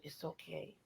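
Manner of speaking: very soft reassurance; calm her gently; soothing. Retold or imitated. Reassuring Gail about the wig, very soft.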